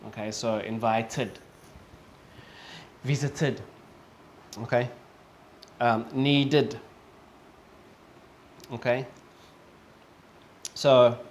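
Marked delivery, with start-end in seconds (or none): 0.6-3.6 "invited" and "visited" with a full last "id" syllable; careful model "invited", "visited", endings distinct; clear ID ending. Shown as a demonstration. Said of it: "the E D sounds clearer". 6.1-6.8 "needed" ending in a separate "id" syllable; careful model "needed" in two syllables. Shown as a demonstration. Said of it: "sounds like an I D".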